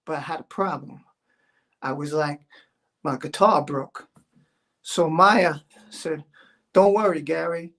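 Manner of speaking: low easygoing guy voice, chatting to the stream; Gary voice. Acted. Streamer as Gary introduces his song, low laid-back dude.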